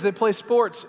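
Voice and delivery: ordinary delivery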